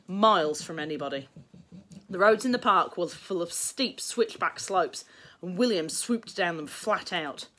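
plain reading voice

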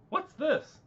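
high-pitched, loud, puzzled query; startled question. Acted. Devon spots something while checking for lethal gases, startled question. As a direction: pitch leaps up on a sudden discovery.

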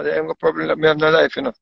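ordinary talk

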